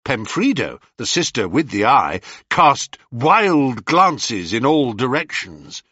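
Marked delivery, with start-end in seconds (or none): none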